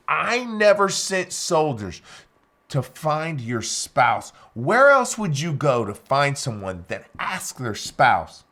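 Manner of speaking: emphatic insistence. Retold or imitated. Pleading interrogator pressing the prisoner.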